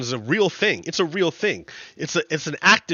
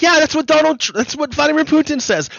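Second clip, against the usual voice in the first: mocking retort; 12 semitones higher than usual. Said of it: "And I'll be like".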